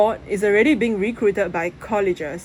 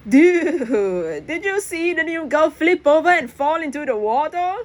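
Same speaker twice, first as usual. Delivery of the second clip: high, gleeful mocking tone; mocking gossip; 9 semitones higher, 4 dB louder than usual. Press to his friend, gleefully mocking the new girl's fall.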